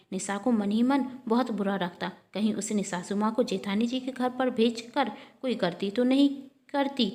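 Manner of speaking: ordinary delivery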